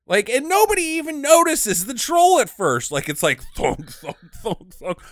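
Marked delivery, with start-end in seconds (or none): 3.6-5.0 low, soft imitated thuds; hollow, muted thunking; thudding footsteps. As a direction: mimic a troll's plodding steps. Imitating troll's unnoticed heavy footsteps.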